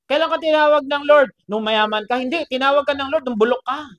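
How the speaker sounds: loud, high questions hammered out; forceful preaching. Real, not acted. Preacher challenging the congregation on when God called, forcefully. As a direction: fire rhetorical questions at the crowd loudly.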